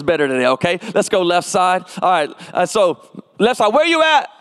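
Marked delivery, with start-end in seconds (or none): 3.7-4.3 high, hollered call to the crowd; rallying pulpit yell, pitch way up; call-and-response shout. Real, not acted. Calling to left side of congregation.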